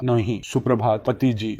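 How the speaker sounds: a hushed, gentle good morning to his wife; soft and tender. Acted. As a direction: murmur it softly, lovingly. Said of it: "नरम आवाज में कहा". (नरम, प्यार भरी धीमी आवाज़ में)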